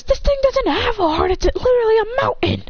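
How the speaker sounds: high-pitched exasperation; acted indignant outburst, pitch way up; exasperated protest. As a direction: object as if it's absurd. Hero protests in exasperation mid-fight.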